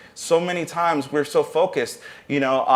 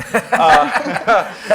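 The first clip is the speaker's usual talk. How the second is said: a burst of real laughter, higher and louder; 5 semitones higher, 6 dB louder than usual. Real, not acted. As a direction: laugh out loud at your own joke. Laughs at his own flute remark.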